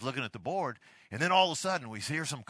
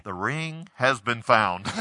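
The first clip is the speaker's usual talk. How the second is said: PA announcer; 3 semitones lower, 9 dB louder, slower than usual. Retold or imitated. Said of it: "the P A announcer comes across and says".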